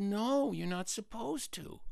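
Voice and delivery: soft, high, slowing answer; small high voice of an obedient child; meek good girl. Acted.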